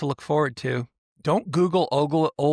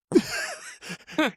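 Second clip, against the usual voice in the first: laugh; 13 semitones higher than usual. Real, not acted. Laughing at the prank.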